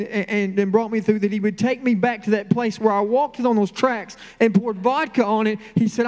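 high, impassioned testimony; fervent preaching. Real, not acted. Preacher testifying to the congregation about God's redemption, fervent. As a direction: preach it with rising fervor, pitched high.

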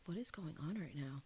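hushed, baffled aside; retold under-the-breath astonishment; bewildered whisper. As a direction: whisper it as if to a friend. Retold aside to friend at bar.

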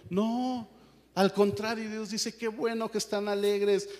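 high, loud exhorting voice; fiery preaching. Acted. Pastor telling the church God loves joy, fiery preaching. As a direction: keep the fire up, preaching hard.

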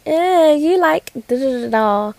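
teasing friend. Retold or imitated. Friend prodding her about who she likes, teasing.

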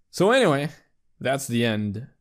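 usual speaking voice

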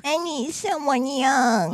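put-on high, slow baby talk. Shown as a demonstration. Co-host demonstrates how it's done, slow baby voice. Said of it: "How do you do a baby voice then?".

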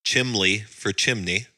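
slow "Chimley" then "chimney" with a clear n; Chimley versus chimney. Shown as a demonstration. Quoting grandma's word beside the proper one, slowly. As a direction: draw out "Chimley for chimney" to show the difference. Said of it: "grandmother used to say".

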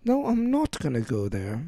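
softly spoken mock British accent; British girl. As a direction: imitate a British girl's accent. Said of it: "How can you not love a British girl?".

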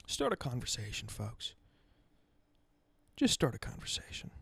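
soft, hushed plea to listeners; quiet urging. Real, not acted. Podcast host softly urging his listeners to reach out, hushed. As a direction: drop to a near whisper and urge.

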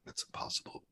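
whispered private doubt; whispered disbelief; inner thought. Retold or imitated. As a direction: hush it like a thought to yourself. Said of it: "And I just thought".

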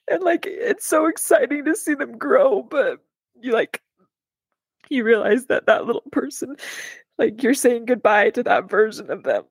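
high, breaking voice through tears; crying while talking, ending in a whisper. Real, not acted. Mom crying about her daughter growing up, tearful whisper. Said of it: "You're gonna make me cry".

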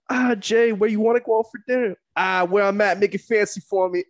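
high, loud rapper impression; loud hyped-up [name] voice. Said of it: "a conversation between [name] and [name]".

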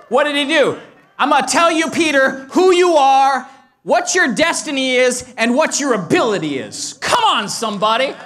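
loud, high, ringing preacher's call; preaching at full force. Acted. Preacher rousing the church, at full force. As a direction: lift the congregation with it.

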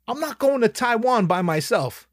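exasperated insistence to his friend; heated refusal. Retold or imitated. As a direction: hammer home that you won't go alone.

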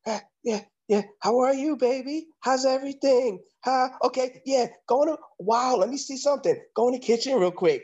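high-pitched, flustered stalling; nervously over-friendly, pitched high; mock nervous woman. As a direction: imitate her covering up in a high voice. Nervous woman stalls her fiancé.

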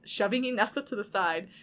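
plain reading voice

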